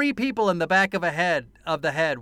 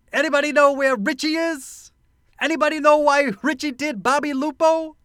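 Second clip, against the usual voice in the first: high, loud Brooklyn tough guy; Brooklyn accent; 10 semitones higher, 6 dB louder than usual. Retold or imitated. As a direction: bark it like a Brooklyn cop. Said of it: "This line is the line of the movie".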